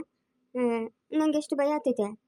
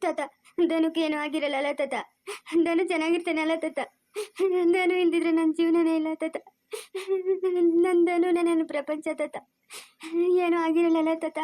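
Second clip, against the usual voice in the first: sobbing, words drawn out, begging for reassurance; crying. Acted. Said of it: "ಅಳ್ಬಾರ್ದು ನೀನು, ಅಳ್ಬಾರ್ದಮ್ಮ".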